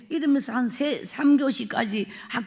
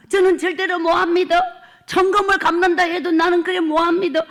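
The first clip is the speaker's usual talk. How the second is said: higher, louder desperate refusal; 6 semitones higher, 7 dB louder than usual. Retold or imitated. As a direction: refuse with all her strength, voice raised. Mother refuses to pour drinks.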